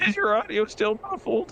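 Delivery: high-pitched teasing echo; mocking mimicry. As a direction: parrot the question back in a high voice. Mimics the other player's question.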